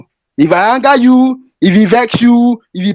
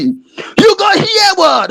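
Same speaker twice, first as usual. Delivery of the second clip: stern warning shout; 8 semitones higher, much slower than usual. Real, not acted. Warning the country, rising rant.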